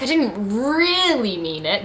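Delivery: REALLY drawn out with a big swoop in pitch; stress on REALLY. Shown as a demonstration. Reader showing what italics do to a sentence, heavy emphasis on REALLY. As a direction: lean hard and long on "really". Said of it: "like, emphasis on that word".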